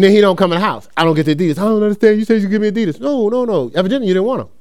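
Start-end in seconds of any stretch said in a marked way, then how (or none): 1.6-3.0 high-pitched mock complaint; complaining son. Son protesting to his father over Adidas, imitated high whine. 3.0-4.5 dismissive father. Retold or imitated. Father rebuts son's complaint.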